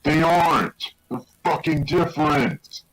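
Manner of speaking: high, insistent angry correction; exasperated. Real, not acted.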